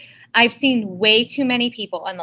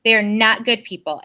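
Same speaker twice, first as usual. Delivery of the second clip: ordinary talk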